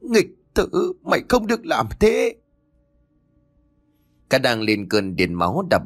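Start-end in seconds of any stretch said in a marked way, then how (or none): 0.0-2.3 high, desperate scream. A narrator's reading. Mother screaming at her son to stop, desperate. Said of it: "Bà Nga gào lên".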